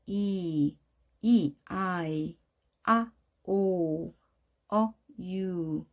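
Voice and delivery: 이, 아이, 오, 유 drawn out slowly as long vowels; lengthened vowels. Shown as a demonstration. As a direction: stretch 이, 아이, 오, 유 against short vowels. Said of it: "이렇게 길어진다고 해서 장모음". (이, 아이, 오, 유를 장모음으로 길게)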